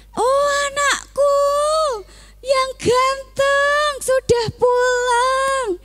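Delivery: very high, slow and overjoyed; overjoyed father. Acted. A father welcoming his son home, overjoyed. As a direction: stretch it out in delight.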